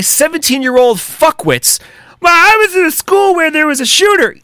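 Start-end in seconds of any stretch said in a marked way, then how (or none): 2.2-4.4 mocking whiny victim. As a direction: sneer it out as a whiny kid playing victim. Mocking a school shooting survivor.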